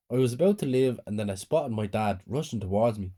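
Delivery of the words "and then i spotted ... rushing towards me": plain reading voice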